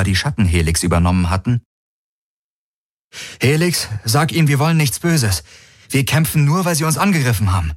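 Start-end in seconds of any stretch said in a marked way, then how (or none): 3.4-7.7 urgent appeal. A narrator's reading. Urgent plea to the aliens.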